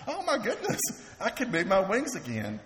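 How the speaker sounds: high, laughing delight as the little bird. Acted. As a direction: chuckle through it, thrilled it can fly.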